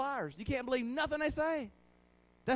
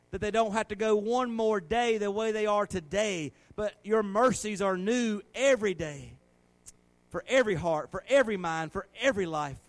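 loud, impassioned pleading prayer; fervent prayer; 5 dB louder than usual. Real, not acted. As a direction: lift the prayer up with force. Prays fervently.

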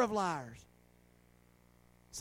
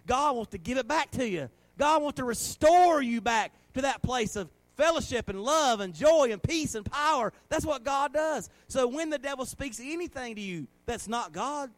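forceful preaching; 2 semitones higher, 6 dB louder than usual. Real, not acted. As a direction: proclaim it with rising fervor to the church.

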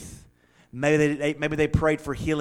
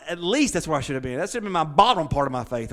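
ordinary delivery

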